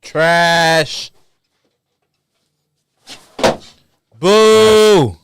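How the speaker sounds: loud mock boo. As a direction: boo him off the stage. Booing the skater.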